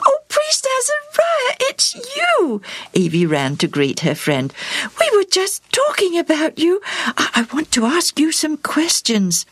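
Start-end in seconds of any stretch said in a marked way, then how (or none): 0.1-2.6 put-on very high, delighted girl's greeting; a little girl thrilled to see her friend. 5.0-9.5 put-on high, eager young girl's voice; a little girl keen to ask. Evie speaks to the priest.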